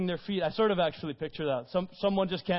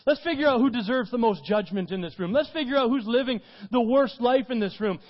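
louder, pointing the finger; mock accusing; 5 semitones higher, 5 dB louder than usual. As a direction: play the self-righteous crowd. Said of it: "start trying to point around and say".

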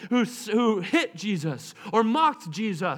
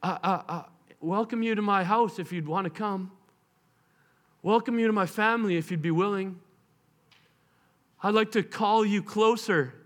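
tender invitation; 2 semitones lower than usual. Acted. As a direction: invite them in kindly. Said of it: "He goes".

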